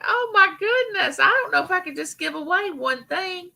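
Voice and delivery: high, amazed gush; mock amazement. Streamer retelling her shock at sixty-six giveaway entries, high and amazed. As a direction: replay her own shocked reaction. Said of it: "I was like".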